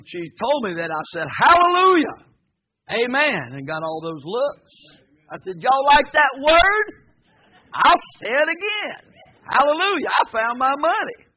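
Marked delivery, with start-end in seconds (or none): 1.4-3.5 high, loud, joyful hallelujah; jubilant exclamation. Retold or imitated. Exclaims on hearing money was found. 5.6-11.3 loud defiant rejoicing. Retold or imitated. Defiant reply to staring bystanders.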